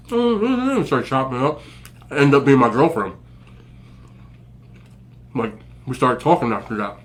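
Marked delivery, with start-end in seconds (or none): 0.1-0.9 mock high-pitched girl; squeaky girlish question. Girl on the phone meeting him, high mock voice.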